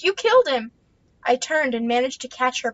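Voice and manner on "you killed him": much higher, much louder accusing shriek; hysterical scream. Acted. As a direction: scream it at her son's rescuer. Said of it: "she screamed".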